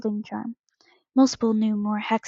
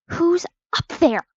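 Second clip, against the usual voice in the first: high, loud, spiteful call; Snape; 7 semitones higher, 5 dB louder than usual. A narrator's reading. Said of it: "called in his nasal, spiteful voice".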